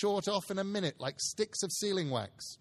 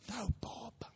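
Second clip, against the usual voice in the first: whispered, much softer; hushed aside; 6 semitones higher, 9 dB quieter than usual. A narrator's reading. Narrator tells listeners Bob is late, in a whisper. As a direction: breathe it as a secret.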